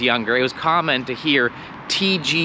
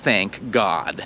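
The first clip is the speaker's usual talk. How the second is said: slow reverent aside; 5 semitones lower, much slower than usual. Shown as a demonstration.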